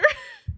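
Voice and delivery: quick squeaky laugh; a sudden high laugh; high burst of laughter. Real, not acted. Laughing at the food on stream, high squeaky laugh. Laughs at peanut butter choice.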